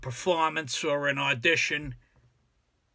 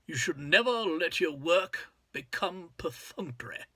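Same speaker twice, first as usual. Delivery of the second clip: measured, put-on British accent; 3 semitones higher than usual. Shown as a demonstration. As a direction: try the quote in British English. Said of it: "Uh, British".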